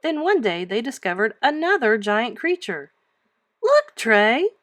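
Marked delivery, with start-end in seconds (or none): 3.6-4.5 high, loud eager call; excited cry. Acted. Girl spots a new fossil and calls her dog, thrilled. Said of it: "Mary cried".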